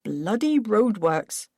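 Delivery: clipped irritation at the traffic; terse. Acted. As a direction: spit out the complaint tersely. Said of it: "said Paul tersely".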